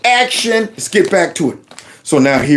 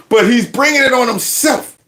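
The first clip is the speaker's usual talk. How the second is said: ordinary talk, nothing marked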